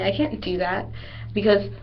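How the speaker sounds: ordinary talk, nothing marked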